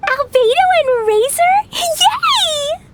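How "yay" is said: a bright, high little yell of joy; happy shout of yay; excited cheer. Real, not acted. Paimon cheers at friends' arrival.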